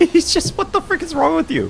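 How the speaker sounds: high squeaky exasperated cry; squealing outrage. Real, not acted. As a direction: shriek it in disbelief at TikTok. Mock outrage at TikTok.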